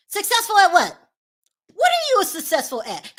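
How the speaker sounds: sassy disbelieving jab; mocking challenge. Real, not acted. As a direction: scoff at the claim.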